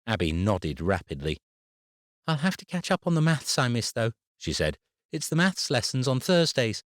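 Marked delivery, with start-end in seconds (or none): 2.3-6.8 put-on high child's voice, eager; a little girl remembering maths class; earnest young girl. A narrator's reading. Abby voiced by narrator.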